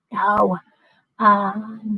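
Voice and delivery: ordinary talk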